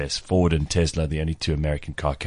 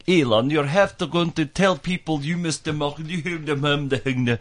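much higher, put-on mock accent; Arianna Huffington's accent; 10 semitones higher than usual. Host mocking Arianna Huffington lecturing Elon, in a high put-on accent. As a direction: lampoon her distinctive way of talking, pitched way up. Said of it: "'cause she speaks like that".

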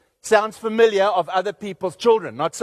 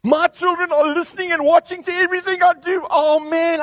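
alarmed parent; 9 semitones higher, 4 dB louder than usual. Retold or imitated. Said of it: "you go".

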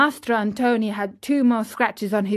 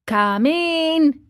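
higher, drawn-out call; 7 semitones higher than usual. Acted. Carpenter to a knock at the door, calling out. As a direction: call it out to a visitor.

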